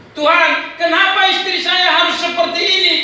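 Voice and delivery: high, loud plea to God; anguished outcry. Retold or imitated. Husband questioning God about his wife, anguished outcry. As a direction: cry out the question at full voice.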